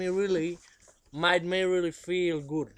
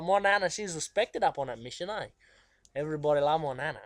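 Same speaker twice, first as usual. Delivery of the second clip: normal talking voice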